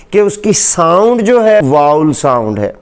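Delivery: ordinary delivery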